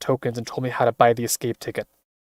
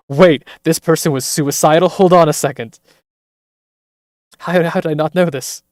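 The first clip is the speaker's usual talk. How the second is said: loud, higher, stunned disbelief; loud stunned disbelief at the twist; shocked outburst; 4 semitones higher, 9 dB louder than usual. Real, not acted. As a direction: blurt it out in real shock. Shocked reaction to reveal.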